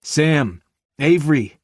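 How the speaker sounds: loud desperate call; shouting names. Acted. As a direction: call out across the wreckage. Calling out through the rubble.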